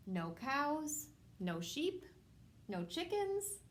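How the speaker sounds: plain reading voice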